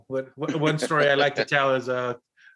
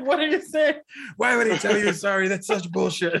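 much higher, laughing indignant rant; mock outraged sister; 7 semitones higher than usual. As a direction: throw the blame back, half laughing. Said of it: "I was just like".

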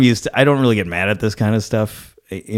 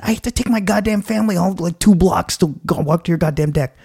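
inner fuming; 9 semitones higher than usual. Retold or imitated. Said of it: "I didn't say this".

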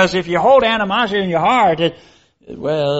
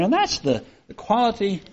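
normal talking voice

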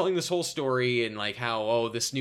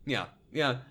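ordinary talk, nothing marked

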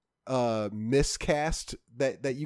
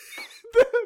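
high laugh; 6 dB louder than usual. Real, not acted. Laughing at joke.

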